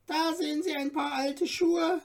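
high, eager little dwarf guide; courteous little dwarf. A narrator's reading. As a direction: play the tiny helpful dwarf showing off treasures. Dwarf shows the shoes.

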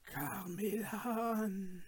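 slow, hushed, drawn-out sighing call; ghostly sigh. A narrator's reading. The cave sighing after the fleeing man, eerie and soft. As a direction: sigh it out like a spirit from below. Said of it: "seufzte es noch einmal aus der Höhle herauf".

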